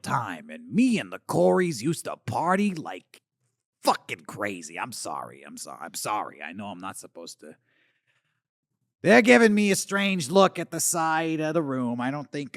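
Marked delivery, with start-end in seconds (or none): 4.8-7.5 sheepish apology. Acted. Apologises after swearing on kids' show.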